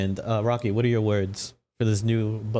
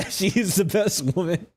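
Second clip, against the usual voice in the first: high, slow giggling line; laughing; 8 semitones higher, slower than usual. Real, not acted. GM laughing as he tells the table the hag is best woman.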